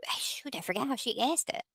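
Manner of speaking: whispered to herself, annoyed at forgetting. Real, not acted. Whispering to herself after forgetting the viewer's question. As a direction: breathe it out, frustrated.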